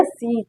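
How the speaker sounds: सीज with a soft z buzz at the end; सीज said slowly with the final s voiced as z; demo ending sound. Shown as a demonstration. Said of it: "उसका sound क्या हो गया".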